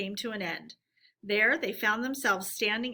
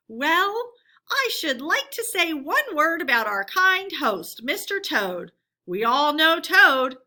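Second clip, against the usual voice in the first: a hint of a mocking after-dinner speaker, louder and higher; lightly voiced weasel speaker; 5 semitones higher, 7 dB louder than usual. A narrator's reading. Weasel mocking Toad at the banquet, mildly mocking toast. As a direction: play up a sly toast. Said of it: "a voice could be heard to say".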